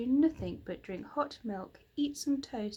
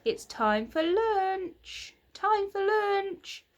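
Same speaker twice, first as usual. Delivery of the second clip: slow, higher, louder drawn-out call; 10 semitones higher, 5 dB louder, much slower than usual. Acted. Mother calls the children in for lunch, slow, higher and louder. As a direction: sing it out across the lane. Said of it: "their mother's voice from the cottage".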